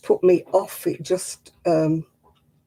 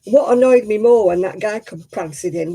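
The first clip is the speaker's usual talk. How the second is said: ordinary talk, nothing marked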